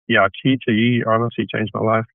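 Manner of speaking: normal talking voice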